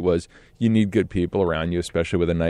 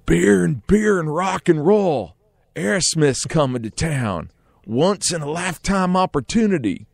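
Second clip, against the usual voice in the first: put-on drunk dude; 8 semitones higher than usual. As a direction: imitate a big lumbering drunk bellowing. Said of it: "drunk dude wandered up on us".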